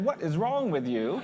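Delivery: slow, incredulous comic retort; mock indignation. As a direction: play up the disbelief, drawing it out. Mock indignant reply to hosts.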